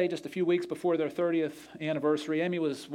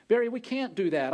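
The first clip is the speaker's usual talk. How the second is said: higher pleading "Barry, we can't do that", stress on "Barry"; lifted, urgent plea to the husband; pleading protest; 7 semitones higher than usual. Retold or imitated. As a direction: cries out "Barry" higher, then falls. Wife protests new diamond.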